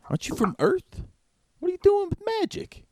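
high, fast incredulous squeak; shocked NPC can't believe Earthlings use magic; surprised NPC. Acted. Startled stranger asks about magic, high and fast.

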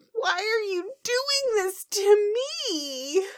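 high, slow, wounded plea; high slow hammed-up whimper; hurt bride's cry. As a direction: plead like a wronged bride. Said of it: "Princess Cadance goes".

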